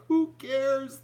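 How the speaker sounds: high, loud who cares; incredulous. Real, not acted. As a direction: scoff at how pointless it is. Mocking CNN's porn star focus.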